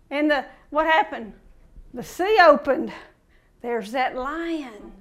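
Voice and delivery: usual speaking voice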